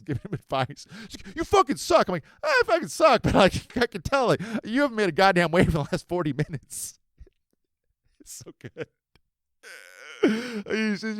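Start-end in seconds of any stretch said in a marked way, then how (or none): 1.3-2.1 put-on high, loud teen jab; insulting kid. 2.4-7.0 high and loud comeback with a laugh; loud retort. Retold or imitated. Retort to kid. 7.0-9.0 laughing whisper. Real, not acted.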